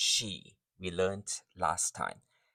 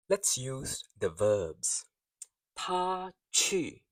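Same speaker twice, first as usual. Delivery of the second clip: ordinary delivery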